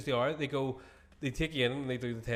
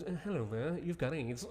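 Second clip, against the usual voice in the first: Games Master voice; 9 dB quieter than usual. Retold or imitated. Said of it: "it comes up like Games Master".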